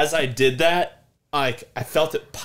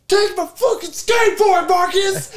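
high, loud angry yell; angry shout at friend over skateboard; retold furious shout; 8 dB louder, slower than usual. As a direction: scream it at your friend in rage. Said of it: "I was so mad".

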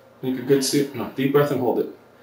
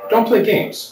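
sharp, raised warning; stern warning; 9 semitones higher, 6 dB louder, slower than usual. Real, not acted. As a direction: scold her firmly. Officer scolding suspect.

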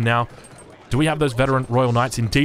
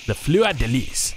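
slow, drawn-out French accent on "Fleur de Lis"; best French accent; slower than usual. Shown as a demonstration. Caster repeating the shield's name for viewers, in a French accent. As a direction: put on your best French accent, slowly. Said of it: "with my best French accent".

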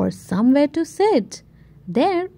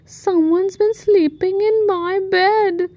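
rumbled; 7 semitones higher than usual. Acted. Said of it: "rumbled Mummy Bear".

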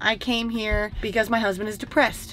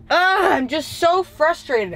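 drawn-out exasperated ugh; real fed-up sigh of a line; frustrated groan; 5 semitones higher, 3 dB louder, slower than usual. Real, not acted. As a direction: let the frustration spill out slowly. Venting frustration at camera.

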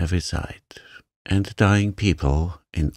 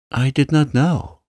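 high, louder protest; raised, anguished exclamation; distressed cry; 7 semitones higher, 5 dB louder than usual. A narrator's reading. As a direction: cry out in panicked self-defence. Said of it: "the Mem Sahib cried".

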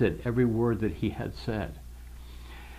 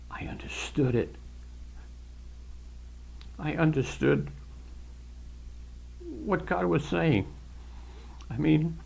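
real choked-up testimony, voice catching into whispers; tearful blubbering; 4 semitones higher than usual. Real, not acted. Believer recalling first understanding God's word, overcome and tearful. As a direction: fight back tears, with long halting pauses. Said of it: "I'm sorry I'm blubbering".

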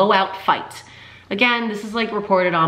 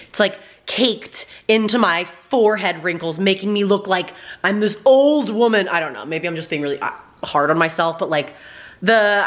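ordinary delivery

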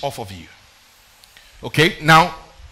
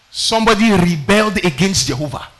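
ordinary talk

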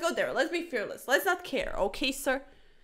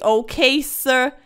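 usual speaking voice

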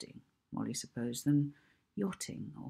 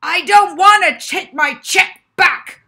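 much louder, much higher bellow; booming; 13 semitones higher, 20 dB louder than usual. Acted. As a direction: boom it at the librarian. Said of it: "boomed the ugly man".

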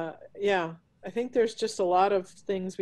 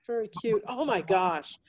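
ordinary talk

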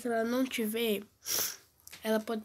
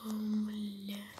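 talking low; 4 dB quieter, much slower than usual. Real, not acted. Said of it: "eu tô falando baixo".

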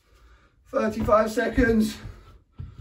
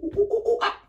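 high ooh-ooh-ah monkey calls; monkey noises; 13 semitones higher, faster than usual. Shown as a demonstration. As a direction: hoot like a cheeky monkey. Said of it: "cheeky monkey".

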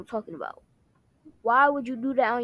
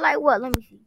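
ordinary talk

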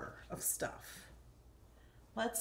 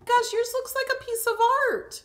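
excited; 12 semitones higher, 6 dB louder than usual. Real, not acted. High excited praise.

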